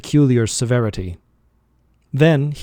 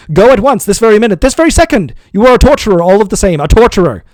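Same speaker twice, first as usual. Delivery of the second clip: much higher, loud shrieking rage; frantic screaming; 9 semitones higher, 9 dB louder, faster than usual. A narrator's reading. Master screaming at servant to go now, frantic. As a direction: scream it, losing control.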